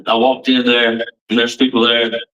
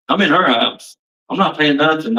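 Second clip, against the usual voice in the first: normal talking voice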